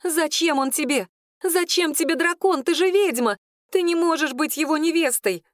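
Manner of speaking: high, protesting voice; upset jealous girl. Acted. Claudine tells the witch she cannot be his bride, high and indignant. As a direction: challenge her, pitched well up. Клодина в ссоре с ведьмой.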